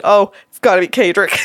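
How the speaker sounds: loud laughing realization; laughing guess. Retold or imitated. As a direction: blurt the name through a laugh. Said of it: "Like this".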